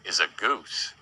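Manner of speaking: ordinary talk, nothing marked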